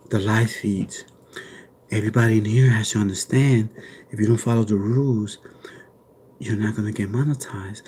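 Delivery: low, soft mock ASMR voice; hushed mock ASMR with some whispered lines. Joking to the live chat, a low mock ASMR voice. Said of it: "I could do some A S M R".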